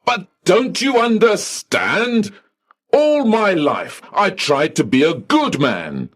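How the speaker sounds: high pleading voice; desperate high plea. Acted. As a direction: beg him to understand. Pleading with clerk.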